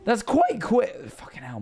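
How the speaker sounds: normal talking voice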